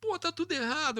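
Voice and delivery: high panicked outburst. Retold or imitated. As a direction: blurt out the disaster. Retold panic over missing pages.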